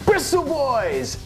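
slow, high product announcement; excited. Acted. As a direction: draw out the name like a big reveal. Big product name reveal.

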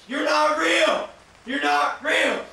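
loud, high, insistent denial; defiant shouting. Real, not acted. Player confronting the horror figure, defiant shouting. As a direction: yell it at the thing scaring you.

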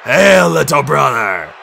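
loud, slow drawn-out hail; huge slow shouted welcome; booming greeting. A narrator's reading. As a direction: bellow a giant's hello to a brother. Giant greeting across a battlefield.

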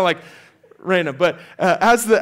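ordinary talk

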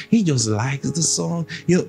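ordinary delivery

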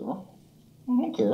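very high, slow crooned I don't care. Real, not acted. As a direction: stretch it out cooing at the cat. Cooing at the cat.

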